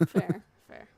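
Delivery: usual speaking voice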